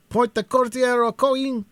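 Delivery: high, loud eager pursuer's cry. A narrator's reading. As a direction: call the chase on, eager and urgent. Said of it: "cried an eager pursuer".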